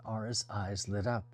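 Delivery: usual speaking voice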